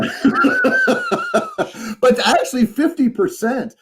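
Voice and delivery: laughing through the words; breaking into a laugh. Real, not acted. Laughing mid-sentence.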